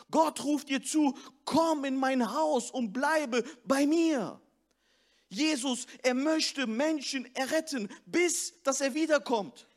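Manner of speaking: rousing sermon voice, pushed and driving; fervent preaching. Acted. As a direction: preach it to the whole hall, building. Preaching at full force.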